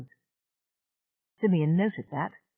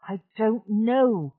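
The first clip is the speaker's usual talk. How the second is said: slow, heavy and grim; grimly, slow; 5 semitones higher, 3 dB louder, much slower than usual. A narrator's reading. Channa conceding to Simeon, slow and grim. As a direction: drag it out grimly. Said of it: "she said grimly".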